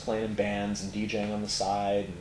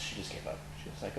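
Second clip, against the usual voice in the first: usual speaking voice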